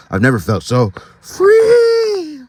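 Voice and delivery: high, slow, shouted cry of freedom; joyful cry. Acted. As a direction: cry out with wild joy. Letter read with joy.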